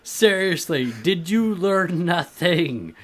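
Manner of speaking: high, drawn-out mock exasperation; scolding Force ghost. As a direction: scold him in hammed-up disbelief. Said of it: "have him scold".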